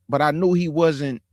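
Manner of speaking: ordinary talk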